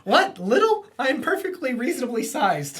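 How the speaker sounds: a hurt little hero protesting his size; offended Iceman. Retold or imitated. Iceman insulted at being called little, high indignant squeak.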